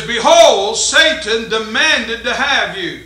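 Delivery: loud, slow scripture proclamation; preaching scripture. Acted. Reading scripture with force.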